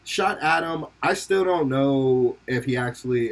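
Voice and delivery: normal talking voice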